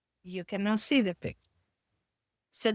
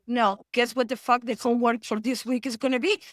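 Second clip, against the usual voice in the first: normal talking voice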